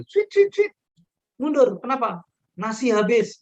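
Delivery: shrill whistle then shout the order back; calling soldiers back. Retold or imitated. Commander calls troops back from battle: high whistled call. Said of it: "anak-anak perang terus dipanggil".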